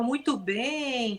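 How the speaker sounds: soft, slow praise; suave. Shown as a demonstration. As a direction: praise the dog gently, drawing it out. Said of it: "com uma voz mais suave".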